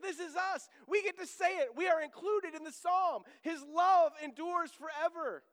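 loud, high preaching proclamation; a pastor's rising call of joy; full-force preaching. Acted. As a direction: shout the good news to the congregation. Preaching climax, congregation included.

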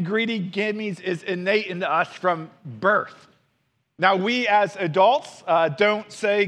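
ordinary talk

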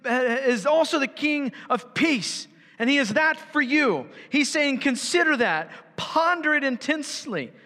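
high, urgent, rising sermon voice; fired-up pulpit intensity; impassioned preaching. Acted. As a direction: preach it with mounting fervour. Preaching at full force.

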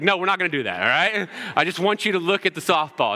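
firm but encouraging coach-dad. Retold or imitated.

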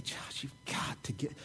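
the buddy's hushed, urgent whisper. Retold or imitated. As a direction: lean in and whisper the advice. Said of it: "he says to me".

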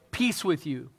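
ordinary delivery

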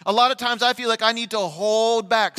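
fervent preacher pouring out his heart; full-force preaching. Acted. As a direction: stretch each word out from the pulpit.

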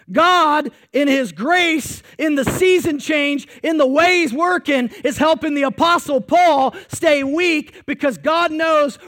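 loud, driving preaching; full-force preaching. Acted. Pastor hammering home to his church, full preaching force. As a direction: pound the point home to the congregation.

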